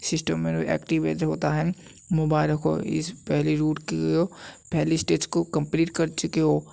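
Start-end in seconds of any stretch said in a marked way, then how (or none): none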